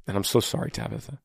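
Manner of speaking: soft, hushed condolence; sincere sympathy. Real, not acted. Host consoling a mother of three kids, softly sympathetic. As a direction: console softly, trailing to near silence. Consoling a listener in marital pain.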